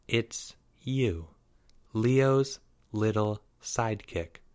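soft, slow put-down; cool and dismissive, spacing the words; quiet disdain. A narrator's reading. Girl greeting her rival's friend, soft disdain.

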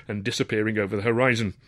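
usual speaking voice

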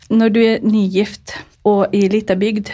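ordinary delivery

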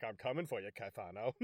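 villain phoning his rival, quiet menace; menacing threat. Retold or imitated. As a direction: mutter the threat like a movie villain. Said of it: "calls Caifano's like".

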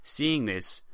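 ordinary delivery

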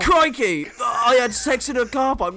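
parroting a bad Hugh Grant, laughing; mocking the impression. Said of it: "He goes like".

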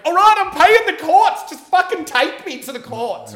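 imitated outburst, very high and loud; desperate shout. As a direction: yell back at them in exasperation. Retold desperate outburst at police.

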